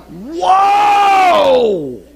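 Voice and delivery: high, loud mock startled shout; big startled whoa. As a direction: recoil in loud mock shock. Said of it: "And I'm like".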